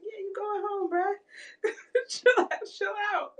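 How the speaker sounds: high, giggly mock reply; words squeaking out between laughs; laughing. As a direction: tease through helpless laughter. Mock reply while cracking up.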